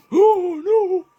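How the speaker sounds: very high, loud falsetto wail; mock horror. Gamer reacting to the game's threat, mock-horror falsetto. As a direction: wail it high in put-on dread.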